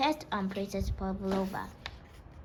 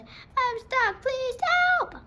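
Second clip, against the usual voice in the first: higher and louder, calling down for help; shouting for help; 5 dB louder, much slower than usual. A narrator's reading. Stuck maid shouting loudly down for help. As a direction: call out as loudly as she can. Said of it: "she shouted as loudly as she could".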